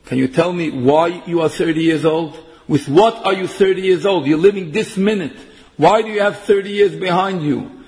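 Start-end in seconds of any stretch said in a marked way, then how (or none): none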